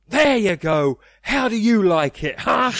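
high, loud mocking taunt; gloating jeer. Loki taunting Thor as Hulk ragdolls him, gloating. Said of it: "gets up out of his seat and goes".

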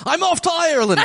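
put-on unclear Irish-ish accent, pitched high, laughing through it; hammed-up, muddled Branson impression with a laugh; mock Branson accent. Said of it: "I don't know what accent I was just doing".

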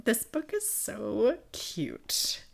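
slow, adoring whisper; hushed gushing. Real, not acted. Reader confiding how much she loves this book, hushed. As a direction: whisper it, drawing the words out fondly.

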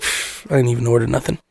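put-on low, dismissive drunk mumble; Rob. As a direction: imitate a woken friend huffing it off. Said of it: "Rob's eyes pop up".

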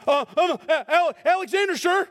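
high, stammering scared soldier; a caught sentry stammering his answer; a hint of a terrified soldier; frightened guard. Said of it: "I'm gonna yell here for a second".

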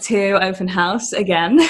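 bright, loud host welcome; loud cheery welcome. Real, not acted. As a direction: call out the welcome to listeners. Re-recorded intro, much louder.

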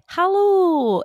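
put-on high Scottish-accented hello. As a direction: say hello like a Scot. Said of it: "you could only say, like".

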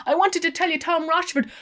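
fast, high, loud gabble; quick breathless plea; hurried. A narrator's reading. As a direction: rush it out before he goes.

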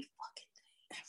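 hushed aside; whisper. Real, not acted. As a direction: whisper it like a secret. Conspiratorial aside to co-host.

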